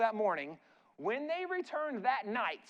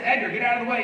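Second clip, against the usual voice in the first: 1 stern order; 8 dB louder, faster than usual. Retold or imitated. Doctor ordering Edgar from door.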